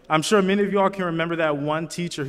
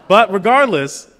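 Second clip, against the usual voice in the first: suddenly much louder and higher, rallying the crowd; rousing emphasis; 6 semitones higher, 12 dB louder than usual. Real, not acted. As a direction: punch it out to lift the room. Rousing graduation speech turning point.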